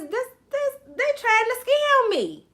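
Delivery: high-pitched mock alarm; suspicious thought. Host acting out trust issues, high suspicious squeak. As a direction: voice a distrustful inner protest. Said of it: "your first thought is".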